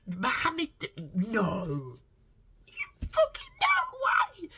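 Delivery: very high, loud comic squeal of disbelief; hammed-up scandalised outburst, shrieking by the end; mock shocked disbelief. Acts out shocked disbelief at a revelation.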